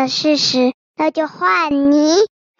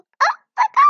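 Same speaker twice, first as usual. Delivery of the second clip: shocked gasp; much faster than usual. Acted. Haha realizes teacher knows.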